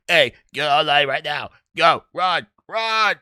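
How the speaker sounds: imitating a drill instructor with a frog in his throat; froggy drill instructor. Recruit mimics how drill instructors really sound, froggy imitation. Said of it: "sound like they got frogs in their throats".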